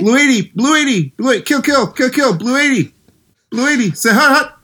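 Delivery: squeaky mock quarterback barking kill, kill; hammed-up Brees calling the cadence; Drew Brees impression.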